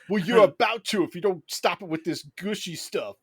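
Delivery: Alpha Six. Acted. Robot scolds the heart-to-heart, loud brash robot impression. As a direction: threaten them in a brash mock-Brooklyn voice. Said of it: "And he's like".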